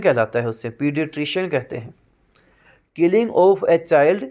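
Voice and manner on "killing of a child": slow, deliberate reading of a definition; slow dictation. Shown as a demonstration. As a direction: read it slowly so students can write it down. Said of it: "dictates definition".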